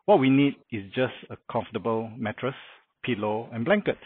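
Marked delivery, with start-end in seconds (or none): none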